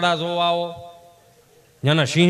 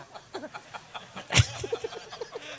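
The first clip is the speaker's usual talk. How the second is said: breathy giggle; 8 semitones higher, 9 dB quieter than usual. Real, not acted. Laugh after the visitor bit.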